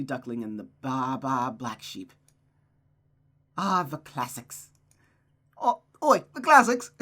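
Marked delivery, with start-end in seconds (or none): 5.6-6.9 loud, high mock-British accent; hammed-up British "oi" shout; Gavin Free impression. Reader jokingly recasting a character, loud mock-British accent. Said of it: "give her that type of accent".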